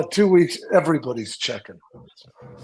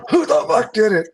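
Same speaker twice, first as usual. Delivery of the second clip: higher, louder furious question; angry demand; 7 semitones higher, 4 dB louder than usual. Retold or imitated. Jim, panting outside, furiously demands who did it. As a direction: bark it out, furious and out of breath.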